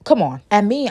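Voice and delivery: usual speaking voice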